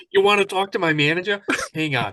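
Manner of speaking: loud, quick, hammed-up Boston accent. Agent offering the manager to a caller, mock Boston accent. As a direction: play a brash Boston phone agent. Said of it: "because of the dialect or the accent".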